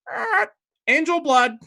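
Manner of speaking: high, loud parrot squawk, far above her own voice; squawking baby phoenix. Acted. Baby phoenix squawks insults at the druid, high loud screech. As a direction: screech it like a rude little bird. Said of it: "and it goes".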